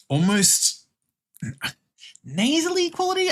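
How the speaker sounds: high, nasally Midwestern put-on. Shown as a demonstration. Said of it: "Midwestern accents typically have that bit more".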